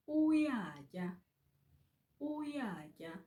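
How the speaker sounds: uyatya with the u pitched high. Shown as a demonstration. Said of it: "Just to get the high pitch".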